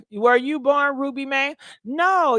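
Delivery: ordinary talk, nothing marked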